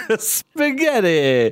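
hammed-up Italian accent, slow and high; mock Italian. Co-host teasing about the spaghetti language, mock Italian accent. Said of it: "That was my Italian".

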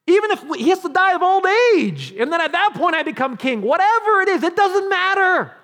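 high, shouted climax; impassioned preaching. Acted. Preacher voicing David's total trust in God's timing, shouting. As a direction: cry it out at the top of the voice.

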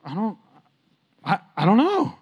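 hesitant, halting with long pauses; caught off guard. Retold or imitated. Pastor replaying his answer to his friend, flustered and halting. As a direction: stall and fumble for an answer. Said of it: "I'm like".

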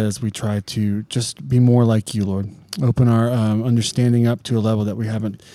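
earnest opening prayer voice. Acted. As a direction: pray sincerely over the group.

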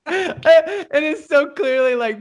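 high, laughing through the words; giggly retelling. Real, not acted. Describing piped-in bathroom noises, giggling and high. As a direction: struggle to explain while cracking up. Cracking up describing fart sound.

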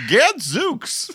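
mock exclamation. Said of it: "he goes".

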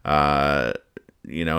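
usual speaking voice

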